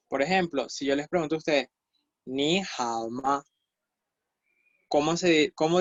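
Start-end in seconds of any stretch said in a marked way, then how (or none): 2.3-3.4 very slow, drawn-out Mandarin phrase; stretched-out teaching pronunciation; slow language demo. Shown as a demonstration. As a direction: model each syllable slowly for learners. Teacher models a Mandarin question.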